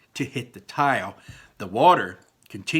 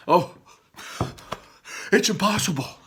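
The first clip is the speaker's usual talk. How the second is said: higher, breathless lament; gasping despair; 7 semitones higher than usual. Acted. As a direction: moan it out of breath. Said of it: "gasping for air".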